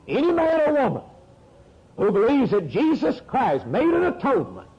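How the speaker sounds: a preacher declaring his claim for the whole world to hear; preaching at full force. Acted. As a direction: hammer it home from the pulpit.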